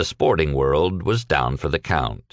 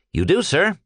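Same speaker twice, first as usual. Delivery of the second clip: happy; 12 semitones higher than usual. A narrator's reading. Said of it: "Mister Peck declared happily".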